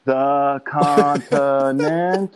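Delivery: mock slow sounding-out, syllable by syllable; imitating the Hooters girl. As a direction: imitate someone struggling to read a nickname. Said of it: "She goes".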